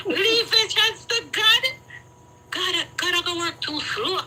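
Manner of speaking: mock her words with disbelief; mocking retort. Mocking her friend's advice on the phone, scornful and high. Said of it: "I say".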